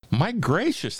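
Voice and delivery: high, amazed crowd exclamation; shouted. A narrator's reading. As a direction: cry it out high in wonder. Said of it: "they shouted".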